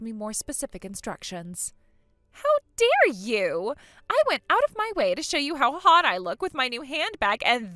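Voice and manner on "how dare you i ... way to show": mock outrage. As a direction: drag it out, hammed-up indignation. [name] offended by ignored selfie.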